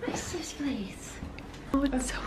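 breathy hushed whisper, slow. Real, not acted. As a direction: whisper it in awe, slowly. Quiet arrival at dark cabin.

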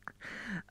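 soft, breathy burst of laughter; real laugh, losing composure mid-reading; quiet giggle at the text; stifled laugh. Real, not acted. Cracks up while reading.

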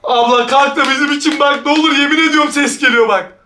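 usual speaking voice

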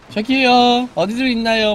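high, chirpy mock of the character; mock sing-song calling out; mocking cheerful girl. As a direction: parody her upbeat voice. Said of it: "막 이러면서".

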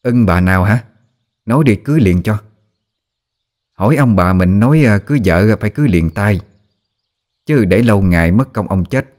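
mildly mocking wifely jibe; sarcastic. A narrator's reading. Wife overhears husband's talk of marrying, sarcastic jibe. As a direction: needle him with pointed questions. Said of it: "rồi cất tiếng mỉa mai".

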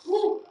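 final هو sounded as a clear hu; ha voiced. Shown as a demonstration. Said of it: "ضع الهاء وعليها ضمة". (نطق هو بوضوح)

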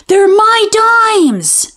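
put-on very high, slow, drawn-out childish protest; fighting kid. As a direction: wail it like a boy fighting over coins. Boys fighting over their dimes.

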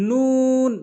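long, high, rounded "নুন"; নুন with rounded mouth. Shown as a demonstration. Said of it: "মুখ গোল করে". ("নুন", মুখ গোল করে টেনে)

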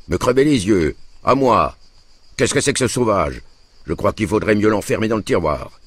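high-pitched affronted outburst; indignant. A narrator's reading. Peasant François threatened by little Paul, indignant. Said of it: "dit François indigné".

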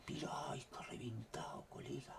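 hushed excited whisper; breathless whispered aside. Real, not acted. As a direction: whisper it in awe. Whispering excitedly over radar.

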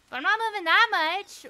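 high, loud exclamation; loud protest. Real, not acted. Streamer protesting while moving, loud high outburst.

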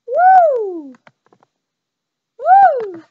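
high, loud whooping cheer; loud woo. Acted. Mock spooky cheer at photo.

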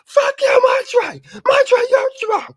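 high, loud shrieking zealot cheer; mocking zealot shout. Mocking religious group, shrieking high and loud. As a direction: screech it like a smug believer gloating. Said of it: "enough for them to be like".

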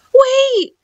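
put-on high-pitched young boy calling out; urgent boy. A narrator's reading. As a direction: jump in high to stop him. Boy stops the shopkeeper.

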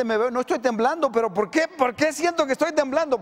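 hungry speaker baffled by his own trembling; puzzled complaint. Acted. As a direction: wonder aloud why you shake. Acted bewildered trembling.

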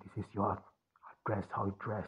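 ordinary delivery

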